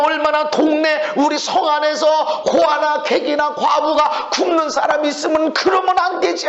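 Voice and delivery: high, insistent protest to God; pleading grievance pouring out without let-up; resentful complaining. Acted. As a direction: act out an aggrieved believer railing at heaven. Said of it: "원망하고 불평하고 그러지 마".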